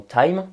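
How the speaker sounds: "time" with a gliding ah-ee vowel; English diphthong. Shown as a demonstration. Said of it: "ce qu'on appelle les diphtongues". (« time » à l'anglaise, avec diphtongue)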